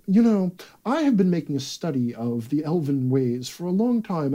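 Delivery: lecturing scholar, sitting up straight; learned, studied delivery of a scholar at his research. Shown as a demonstration. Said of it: "If you ask me to do a scholar".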